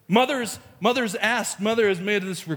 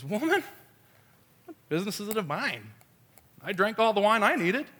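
playful backtalk, pitched up on WOMAN; loving kidding; 4 semitones higher than usual. Retold or imitated. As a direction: tease your mother fondly. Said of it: "very lovingly, kiddingly, I believe".

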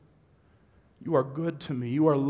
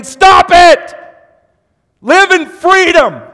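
much higher and far louder, a commanding cry; God's shout; 19 dB louder, much slower than usual. Acted. God shouting at the self-hating congregation, a commanding yell. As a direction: bellow it like God calling down. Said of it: "and God's saying".

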